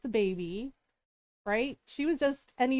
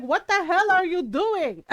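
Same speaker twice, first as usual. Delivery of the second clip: louder, higher mock-outraged mom; mom's outrage; 4 semitones higher, 6 dB louder than usual. Mom coming out to the kids, mock outraged. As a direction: retell a mother's loud, indignant scolding.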